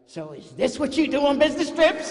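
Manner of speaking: high, loud accusing question; wife catching husband across the aisle. Retold or imitated. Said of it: "and she's like".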